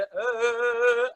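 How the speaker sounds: long wavering "eh"; wavy held note. Shown as a demonstration. Teacher demonstrating waves in the voice, undulating note. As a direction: sustain the vowel in rippling waves. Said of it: "ada gelombang-gelombang".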